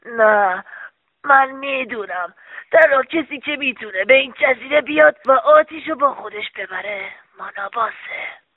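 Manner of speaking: put-on troubled old-man character voice; old man's unhappy objection; upset old man. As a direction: insist as an upset old father. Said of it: "پیرمرد با ناراحتی گفت".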